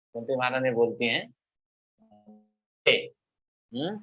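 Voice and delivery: normal talking voice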